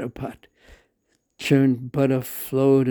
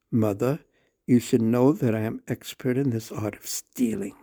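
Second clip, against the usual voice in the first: usual speaking voice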